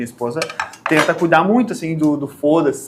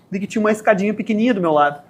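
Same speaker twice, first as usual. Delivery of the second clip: normal talking voice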